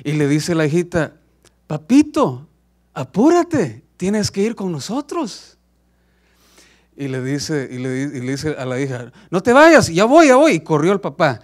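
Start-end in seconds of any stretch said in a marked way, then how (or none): 1.7-5.6 put-on high, childlike urgent call, slowing at the end; a small daughter hurrying her papa; urgent little girl. Niña llama al papá mientras se eleva. 9.3-10.5 high, loud panicked cry; desperate shout. Acted. Padre desesperado grita a la hija.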